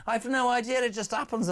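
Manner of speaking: high put-on Scouse voice; Lily Savage. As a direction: answer in a hammed-up Liverpool accent. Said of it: "go into Lily Savage mode when you do a Scouse accent".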